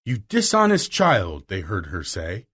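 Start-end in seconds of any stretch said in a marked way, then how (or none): 0.1-1.5 angry scolding. A narrator's reading. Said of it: "Miss Minchin's angry voice".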